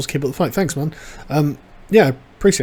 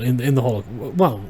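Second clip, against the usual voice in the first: ordinary talk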